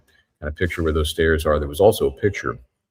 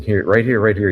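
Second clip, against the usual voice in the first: raised, higher mock yell; retold yell; 5 semitones higher, 6 dB louder than usual. Husband calling paramedics to the backyard, retold yell. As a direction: call out to guide the EMTs over. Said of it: "he's yelling".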